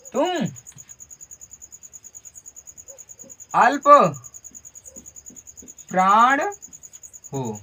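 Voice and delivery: each word set apart with pauses; slow spaced dictation. Shown as a demonstration. Teacher dictating to the class, word by word. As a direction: spell it out slowly for the class. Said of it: "slow spaced repetition for students".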